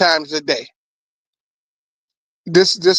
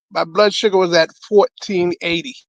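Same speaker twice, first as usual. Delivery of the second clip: usual speaking voice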